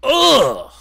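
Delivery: high, loud groan of disgust; loud disgusted ugh. Real, not acted. Disgusted at the streamer, a loud real groan. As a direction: let out a loud fed-up ugh.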